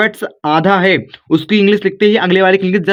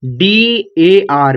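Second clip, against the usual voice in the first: slow spelled letters; much slower than usual. Shown as a demonstration. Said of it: "यहाँ पर दर".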